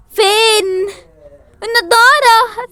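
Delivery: high, loud crying wail; mock panicked wail. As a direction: wail for the lost glasses. Frantic search for glasses. (عويل مذعور ساخر)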